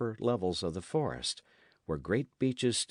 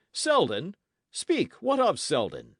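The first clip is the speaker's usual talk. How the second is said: high, loud, urgent demand; alarmed cry; 8 semitones higher, 6 dB louder than usual. A narrator's reading. Knight demanding word of his man, alarmed and urgent. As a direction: demand the news in sudden alarm. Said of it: "he cried in a changed voice".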